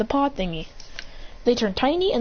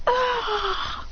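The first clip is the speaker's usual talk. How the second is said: high drawn-out "ah"; falling cry; 13 semitones higher, 3 dB quieter than usual. Retold or imitated. Figure kicked into the water.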